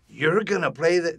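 loud, slow, high incredulous question; drawn-out mock-dad disbelief; disbelieving dad. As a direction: scoff slowly as an imitated dad. Dad disbelieving his son.

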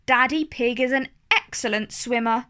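ordinary delivery

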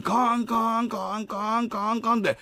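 imitated railroad crossing bell clang; lightly imitated crossing bell. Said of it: "ずーっと踏切の音鳴ってて".

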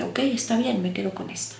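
resigned giving in. Retold or imitated. The mind gives in, resigned.

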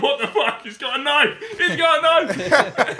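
high, laughing mock alarm, slowing at the end; mock panic. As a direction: ham up the punters freaking out. Pub customers panicking at knife.

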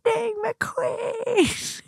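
slow, high, stretched-out name; drawn-out high call. Pretend. Trend line again, drawn out.

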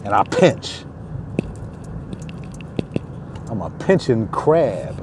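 low, soft crab threat; a hint of a low crab menace; mock menacing crab. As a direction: lightly play the pinching crab.

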